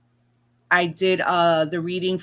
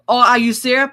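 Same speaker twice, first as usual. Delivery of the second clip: surprised outburst; 4 semitones higher, 8 dB louder, faster than usual. Real, not acted. Reacts to the search result.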